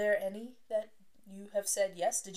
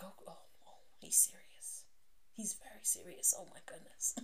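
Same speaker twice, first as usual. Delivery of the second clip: very soft, near-whispered mock alarm; hushed awe; 12 dB quieter than usual. Listener reacting to a serious mob boss, hushed mock alarm. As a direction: breathe it under your voice, as if intimidated. Acting the awed listener of mobster.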